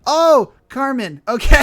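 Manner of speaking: loud, drawn-out surprised "oh". Real, not acted. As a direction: gasp it out in realization. Surprised by game reveal.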